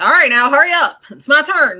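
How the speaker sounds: loud, high, impatient hurry-up; voicing a spirit's pushy urgency; urgent. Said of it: "almost like an urgency".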